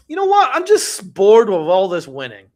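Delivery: a sarcastic send-up of a bored winner; mock bored superstar. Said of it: "is he going".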